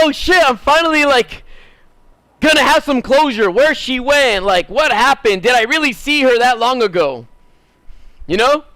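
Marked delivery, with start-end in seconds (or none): 0.0-7.3 higher-pitched excited rush of thoughts. Retold or imitated. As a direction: relive the thrill of finally getting answers. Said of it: "I was all excited".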